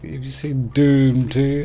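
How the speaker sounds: imagined moviegoer. Acted. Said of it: "So people are like".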